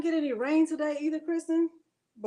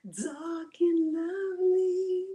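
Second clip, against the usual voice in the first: mock commercial slogan; 5 semitones higher, much slower than usual. Commercial-style slogan, drawn out.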